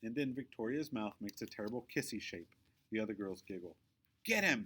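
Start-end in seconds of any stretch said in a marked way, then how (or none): 4.2-4.6 high, loud order to the gang; bully girl calling out the attack; cry out. Acted. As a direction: yell the order to grab him. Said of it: "Victoria cries".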